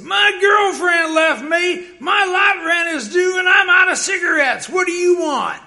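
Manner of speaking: a fed-up tenant shouting at door knockers; angry yell. Retold or imitated. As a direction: yell out every grievance at the visitor. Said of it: "he was yelling at Brad".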